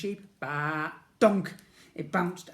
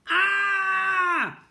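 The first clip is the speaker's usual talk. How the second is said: high piercing scream of fright; 8 dB louder than usual. A narrator's reading. Boy on the cliff screaming in terror at the slide. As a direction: let out a loud terrified scream. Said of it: "and screamed".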